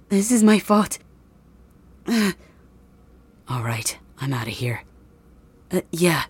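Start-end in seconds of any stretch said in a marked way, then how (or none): 3.5-4.8 whisper. Acted. Villain slips away quietly.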